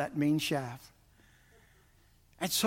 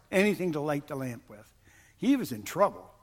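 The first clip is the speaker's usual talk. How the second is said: ordinary delivery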